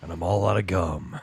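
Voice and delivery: low tough-guy line breaking into a laugh; Duke Nukem. Retold or imitated. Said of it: "And he still says the".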